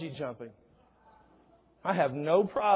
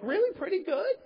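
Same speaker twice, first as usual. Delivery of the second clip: high incredulous rise; a hammed-up, squeaky really; mock disbelief. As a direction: act amazed at the claim.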